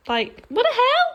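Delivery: high squeaky disbelief; can you believe it; what the hell, squeaked up high; incredulous. Real, not acted. Outraged at cooking for boyfriend.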